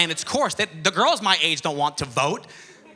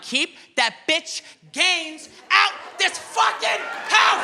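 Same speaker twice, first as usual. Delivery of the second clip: hammed-up screaming rage like Will Smith; furious shout; 12 semitones higher, much slower than usual. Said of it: "I'm turning into Will Smith".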